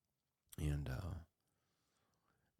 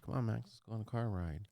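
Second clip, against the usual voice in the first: cheery, higher, louder call to a dog; coaxing the dog; 6 semitones higher, 5 dB louder than usual. Retold or imitated. To Max at the truck, an eager coaxing call. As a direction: sing it out to lure him in.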